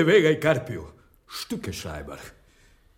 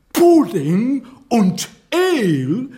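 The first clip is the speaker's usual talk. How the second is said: louder, higher spat-out insult; angry outburst; 6 semitones higher, 6 dB louder than usual. Acted. Lope insulting the English as bloated pudding-eaters, angry and loud. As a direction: let the scorn boil over. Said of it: "Mein Herr, mäßigt euch".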